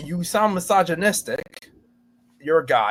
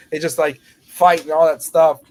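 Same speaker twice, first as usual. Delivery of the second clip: ordinary talk, nothing marked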